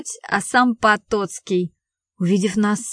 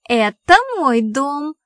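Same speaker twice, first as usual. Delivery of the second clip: slow, higher, insistent retort; emphatic objection; 5 semitones higher, 4 dB louder, slower than usual. A narrator's reading. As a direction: stake the claim with drawn-out emphasis. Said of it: "возразила Томочка". (твёрдое, протяжное возражение)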